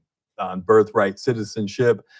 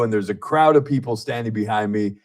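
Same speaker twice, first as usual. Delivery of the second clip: ordinary delivery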